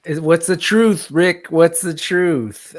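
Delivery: hammed-up demand for the truth; dramatic demand. Playfully demands truth from chatter.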